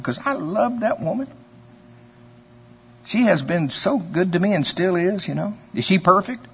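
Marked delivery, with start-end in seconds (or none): none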